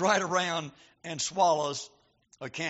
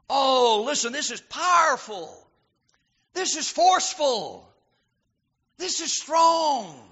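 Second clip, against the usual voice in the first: full-force preaching; 6 semitones higher, 6 dB louder than usual. Acted. Preacher stirring the congregation, fiery full-force preaching. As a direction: build it up and thunder it out.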